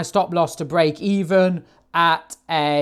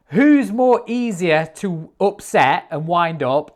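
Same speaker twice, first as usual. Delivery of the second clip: usual speaking voice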